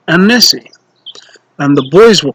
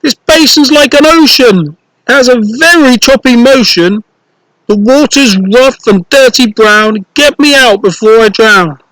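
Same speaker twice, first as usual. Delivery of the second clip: put-on higher, pleading little-boy voice; worried boy; 7 semitones higher than usual. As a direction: beg to be fished out. Sniff stuck in basin.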